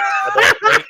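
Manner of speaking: bursting into laughter; laughing. Real, not acted. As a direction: laugh it out loud and high. Laughs at unwashed blanket.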